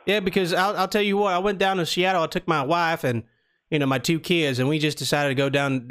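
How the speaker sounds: put-on Southern prank caller; Southern accent. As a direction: talk like a folksy Southern customer. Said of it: "we're doing the Southern accent".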